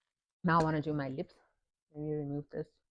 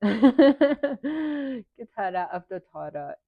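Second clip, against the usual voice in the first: slow, louder, higher Sesotho phrase through a laugh; laughing put-on accent; 7 semitones higher, 9 dB louder, much slower than usual. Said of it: "I don't know why I did an accent".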